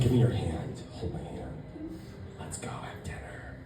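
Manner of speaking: low, soft, breathy-sounding come-on fading to a whisper; sultry imitation of her. Date whispering to the comedian to take her hand, hushed and seductive. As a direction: coax him softly like a seductress. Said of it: "And she's like".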